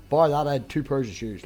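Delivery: ordinary talk, nothing marked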